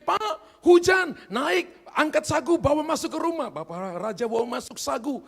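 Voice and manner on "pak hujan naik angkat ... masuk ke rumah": high urgent call to the husband. Retold or imitated. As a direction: call up loud and high, hurrying him. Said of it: "Mama Nyora teriak ini". (panggilan istri yang keras dan tinggi)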